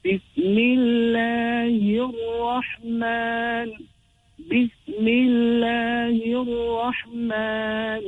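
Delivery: Bismillahir Rahman chanted slowly, l merged into a doubled r; assimilated lam. Shown as a demonstration. Teacher showing lam al-shamsiyya, Bismillahir Rahman slowly recited. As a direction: stretch Bismillahir Rahman, gliding straight into the rr. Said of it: "So penetration will take place".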